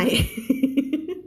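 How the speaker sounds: rising giggle; laughing. Real, not acted. Teacher amused by her own aside, giggling. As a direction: break into a giggle.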